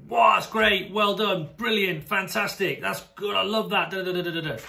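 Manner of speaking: high, bright over-the-top praise; gushing praise. Shown as a demonstration. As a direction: pile on the praise like a cheerleader coach. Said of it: "going in there and saying".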